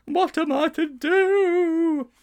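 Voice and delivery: high, slow, drawn-out wail. Acted. Teacher wailing to the boys about Livia, hammed-up sobbing wail. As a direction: wail it out in theatrical despair. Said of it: "he wailed".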